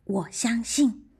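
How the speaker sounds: slow, determined whisper; firm whisper. A narrator's reading. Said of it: "瑞贝卡坚定地说".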